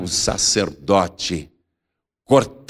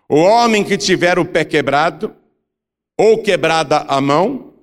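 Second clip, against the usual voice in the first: scripture proclamation; 5 semitones higher, 3 dB louder than usual. A narrator's reading. Preacher reading Leviticus on future priests, solemn proclamation. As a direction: proclaim the law phrase by phrase, higher. Reads scripture list aloud.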